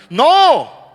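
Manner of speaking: one explosive refusal from the pulpit; emphatic shout. Real, not acted. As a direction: cut the self-pity off hard. Preacher rejects defeatist thinking.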